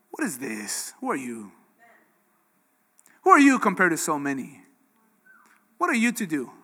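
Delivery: low, whispered taunting voice; doubting inner voice. Retold or imitated. The enemy whispering doubt to the preacher, low and soft. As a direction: hiss doubt into his ear, low and soft. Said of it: "I heard a voice, and it was very similar to this".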